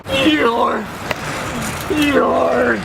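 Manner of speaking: high cry of the name; calling out. Acted. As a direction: call out across the woods. Calling for Eeyore.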